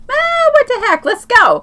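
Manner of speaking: a happy shrug of a yes; carefree mock exclamation. Retelling their snap decision to detour, high and laughing. Retelling deciding to visit cabin.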